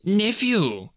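high, stern rebuke. A narrator's reading. Uncle silencing his pleading nephew, stern. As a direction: cut him off sternly. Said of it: "returned the uncle sternly".

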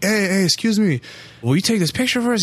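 re-enacted "excuse me" to a stranger; calling a stranger over.

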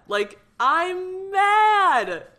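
drawn-out high, loud cry of mad. Real, not acted. Podcaster venting at her haters, a slow loud high cry. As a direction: let the anger ring out long and loud. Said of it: "I'm so mad".

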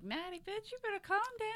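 high-pitched mock indignation; mock scolding. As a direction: put her in her place, playfully high. Retells her scolding reply.

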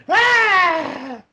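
high-pitched mock scream of fury; long, high retold shriek; imitated scream. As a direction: imitate a wife screaming as she finds out. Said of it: "walked out into the living room and went".

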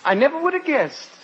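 high-pitched, deadpan mock surprise; sarcastic surprise. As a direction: feign surprise, pitched well up. Mock surprise at obvious English accent.

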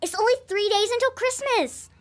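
high, loud, bubbling with news; excited announcement. Acted. Girl tells babysitter Christmas is near, excited. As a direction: burst out with thrilling news.

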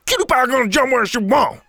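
loud, high-pitched character voice; spitting retort. A narrator's reading. Lifeguard snapping back at a kid about his hog, spat. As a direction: spit it out loud and high, defiantly. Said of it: "Lovekin spat".

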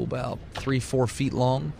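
ordinary talk

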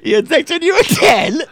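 high laughing guess. Real, not acted. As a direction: squeal it through laughter.

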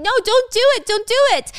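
high, loud panicked pleading; acted scream. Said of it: "Uni's screaming".

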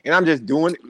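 ordinary delivery

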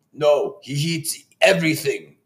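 slow, deliberate chef line, lightly voiced; dubbed chef voice. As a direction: imitate a dubbed movie chef, drawing it out. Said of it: "Voice is totally dubbed".

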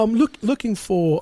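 resume the put-on British caller; hesitant mock-British phone voice; British accent. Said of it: "that was pretty British right there".

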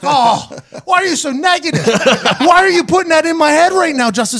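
high, laughing complaint; laughing protest. Retold or imitated. Kicker protesting to the snapper through laughter. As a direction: whine at him through a laugh. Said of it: "I was like".

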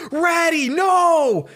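very high, loud mock-anguished scream; panicked cry. Ben seeing Snorlax fall on his Rattata, panicked scream. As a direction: cry out for your pet in exaggerated panic. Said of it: "Ben immediately panics".

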